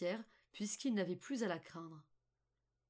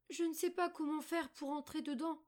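put-on little girl Margot; 7 semitones higher than usual. A narrator's reading. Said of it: "Mais Margot, devinant ses intentions, dit".

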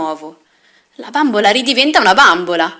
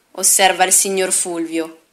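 plain reading voice

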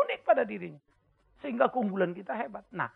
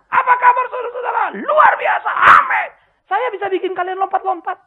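high, loud shouted stage greeting, much higher and louder; mock hype preacher; 11 semitones higher, 9 dB louder than usual. Pastor mocks a motivator preacher working the congregation, shouting. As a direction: whip up the crowd like a showman pastor.

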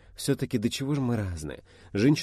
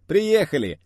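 loud announcement; 11 semitones higher, 10 dB louder, slower than usual. Acted. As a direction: call out loud and high. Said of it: "объявил Айдар".

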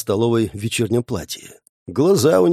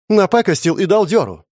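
angry jab; 8 semitones higher, 4 dB louder than usual. Acted. Wife mocks husband.

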